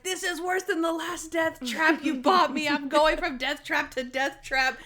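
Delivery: high-pitched, hammed-up complaining; whiny Monk. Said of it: "the whiny Monk was funny".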